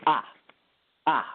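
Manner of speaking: quick falling ah, tapped like a piano note; fourth tone, sharp. Shown as a demonstration. Teaching the host the fourth tone, sharp falling ah. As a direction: snap the ah down fast. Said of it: "it's just very quick".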